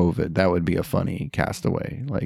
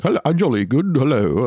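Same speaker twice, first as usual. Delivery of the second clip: higher-pitched mock British accent; foolish fake British accent; 4 semitones higher than usual. American actor trying to sound English, foolish British accent. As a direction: put on a silly, badly faked British accent. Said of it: "I sound foolish if I try to be like".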